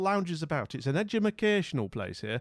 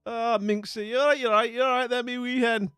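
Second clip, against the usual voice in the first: higher, louder hammed-up Scottish banter; mock Scottish accent; 8 semitones higher, 5 dB louder than usual. Streamer answering a Scottish viewer in mock Scottish accent. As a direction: play a cheeky Scot, laughing.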